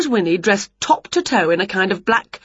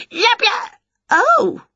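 very high, squeaky yelps; yelping dog; 3 dB louder, much slower than usual. A narrator's reading. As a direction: imitate a startled small yap. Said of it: "said Scruff".

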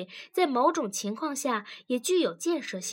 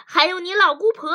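loud, fast, higher jab; fierce wife; 8 semitones higher, 10 dB louder, faster than usual. A narrator's reading. Wife adds to husband's list of relatives, fiercely. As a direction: spit it out fiercely, pitched up. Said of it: "女子挫着牙齿狠狠地说".

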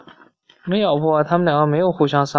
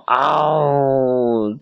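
long mock dinosaur roar; drawn-out storybook roar; imitated roar; 2 semitones lower than usual. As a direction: stretch out a pretend howl.

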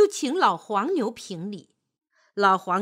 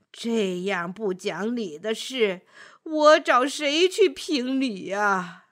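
put-on aggrieved old voice, dragging the words; weary old tree. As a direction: complain wearily like an ancient tree. Old apricot tree complains.

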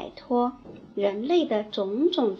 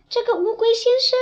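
much higher, quick exasperated voice; exasperated crow; 11 semitones higher, 6 dB louder, faster than usual. Acted. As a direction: sigh it out quickly, way up high. 乌鸦评论乌龟. (不耐烦的乌鸦)

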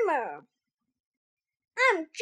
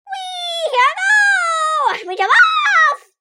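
excited; 10 semitones higher, 8 dB louder than usual. Acted. Gremlin jumps off pony.

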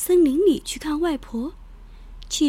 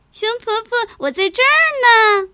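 tiny ant voice; 8 semitones higher, 7 dB louder than usual. Acted. Ant calls from bear's ear.

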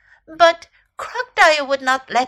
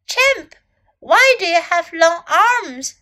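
lightly voiced high, loud question; curious little elephant; 5 semitones higher, 6 dB louder than usual. A narrator's reading. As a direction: call out high, then ask.

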